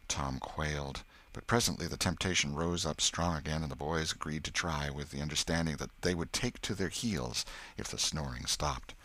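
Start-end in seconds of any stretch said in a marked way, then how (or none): none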